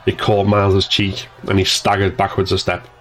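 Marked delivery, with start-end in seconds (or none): none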